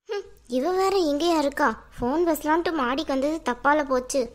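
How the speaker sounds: low-pitched, grumbling inner monologue; irritated woman. Acted. Irritated character's private grumbling.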